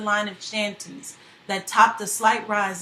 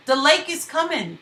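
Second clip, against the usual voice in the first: gasped warning; 5 semitones higher, 4 dB louder than usual. Acted. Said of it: "T K gasped".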